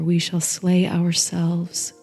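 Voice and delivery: ordinary delivery, nothing marked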